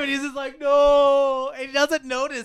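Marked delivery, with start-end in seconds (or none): 0.6-1.5 loud, high wailed "no"; mock crying. Man running from girlfriend the whole time, mock crying. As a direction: wail it like a fleeing movie monster. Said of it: "He's crying".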